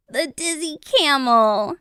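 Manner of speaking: high-pitched tease; high storybook crowd; amused crowd. A narrator's reading. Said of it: "they laughed".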